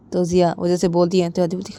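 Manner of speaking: ordinary delivery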